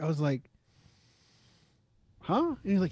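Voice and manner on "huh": high-pitched puzzled huh; acted high disbelief; baffled. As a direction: squeak it in mock confusion. Acts out own baffled reaction.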